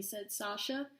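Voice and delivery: plain reading voice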